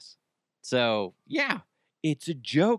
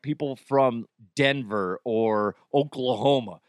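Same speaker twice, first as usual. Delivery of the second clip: normal talking voice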